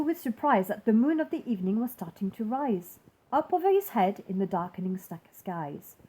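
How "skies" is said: usual speaking voice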